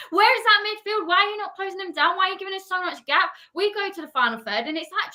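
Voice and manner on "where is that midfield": high, angry repeated demand; exasperated yell. Real, not acted. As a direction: bark it again at the players. Exasperated fan shouting at team.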